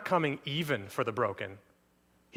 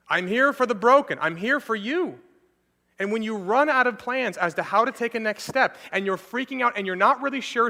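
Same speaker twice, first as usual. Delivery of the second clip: raised, pressing sermon voice, pleading hard; fervent preaching; 6 semitones higher, 5 dB louder than usual. Acted. Pastor to struggling Christians, fervent preaching. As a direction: preach it out with urgency.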